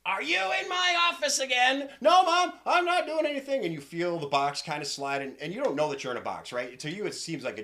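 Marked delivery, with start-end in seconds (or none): 0.0-1.9 high-pitched, loud scolding mom; shrill-high motherly holler; angry mother's shout. Acted. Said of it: "you hear a female voice". 2.0-3.6 put-on high, loud kid voice; loud boyish denial; protesting little boy. Boy answers his scolding mother.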